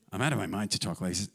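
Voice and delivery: low, quick throwaway aside; parenthetical aside. Acted. Preacher rereading Paul's interjection, parenthetical aside. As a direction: toss it off low and fast. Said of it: "that little parenthetical statement".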